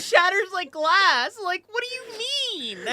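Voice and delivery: very high, squeaky disbelief; can't believe it, voice shooting up; incredulous. Real, not acted. Incredulous outburst.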